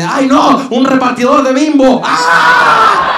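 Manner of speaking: high, loud fake alarm; hammed-up startled shout; distracting shriek. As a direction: cry out in mock panic to divert attention. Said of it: "distrayendo la atención así de".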